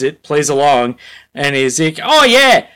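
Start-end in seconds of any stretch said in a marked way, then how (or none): 2.0-2.6 higher, louder hammed-up Australian accent; a cheery Aussie covering a hangover. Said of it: "I wanna make him Australian".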